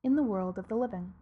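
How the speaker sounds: ordinary delivery, nothing marked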